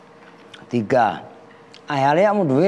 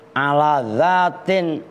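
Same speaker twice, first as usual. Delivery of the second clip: slow recitation; much slower than usual. Shown as a demonstration. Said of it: "teacher reading Arabic text slowly".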